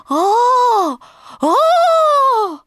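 loud, very high delighted squeal; tiny squeaky cry of glee. A narrator's reading. Baby Roo bouncing up in the air, high squeals. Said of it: "приговаривая".

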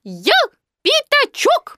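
very high squeaky declaration, broken by a pause; loud insistence. A narrator's reading. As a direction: insist on who you are in a piglet squeal. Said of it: "громко сказал Пятачок". (громко и пискляво, с паузой)

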